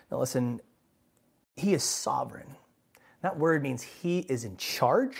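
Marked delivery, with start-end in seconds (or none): none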